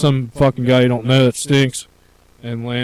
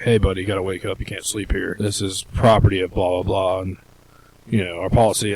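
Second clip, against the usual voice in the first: lower, quieter guard spiel; calm security spiel; 6 semitones lower, 4 dB quieter than usual. Retold or imitated. Guard waking a drunk in garage, lower recited spiel. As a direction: recite the rules flatly. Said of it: "I'm like".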